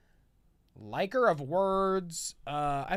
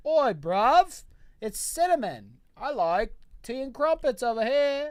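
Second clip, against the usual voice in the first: higher-pitched, hammed-up British accent; Cinnamon's British accent; 6 semitones higher, 4 dB louder than usual. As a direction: do a skit Brit, louder on the greeting. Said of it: "she does a skit where she's like".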